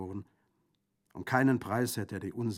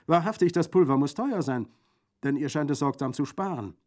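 high, loud amazed exclamation; astonished bandit; 7 semitones higher, 10 dB louder than usual. A narrator's reading. Bandit discovers the double hit, loudly amazed. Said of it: "rief Brandolaccio verblüfft".

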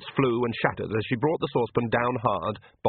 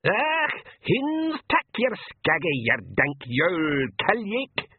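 high, slow shrieked pixie brogue; a tiny furious warrior screeching his threat; much higher and slow, wild little pixie yell; screaming Feegle; 8 semitones higher, slower than usual. A narrator's reading. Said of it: "he screamed".

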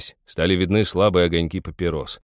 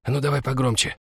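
higher-pitched voice of a lad outside; young man outside; 6 semitones higher than usual. A narrator's reading. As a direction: lad egging his friends on. Lad outside urges friends, hushed.

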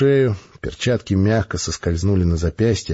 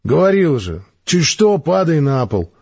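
reproachful; 6 semitones higher, slower than usual. Acted. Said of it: "укоризненно нахмурился тринадцатый".

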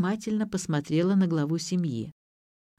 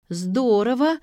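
higher, louder burst of joy; glad, raised-voice reaction; delighted; 6 semitones higher, 6 dB louder, slower than usual. A narrator's reading. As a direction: exclaim it, pleased at the news. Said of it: "обрадовалась Ксю".

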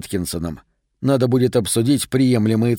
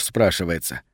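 plain reading voice

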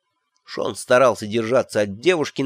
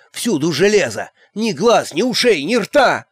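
higher, rising terrified protest; panicked outburst; 7 semitones higher than usual. A narrator's reading. Elf pleading with Magrat against iron armour, panicked. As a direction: blurt it out in horror.

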